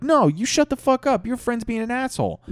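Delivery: very high, outraged yelling; in a fervor. Acted. Everybody yelling down Brad, high and outraged. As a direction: shriek back as an angry mob. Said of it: "everybody's just in a fervor".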